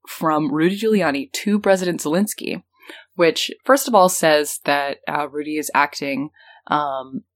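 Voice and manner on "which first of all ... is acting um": ordinary talk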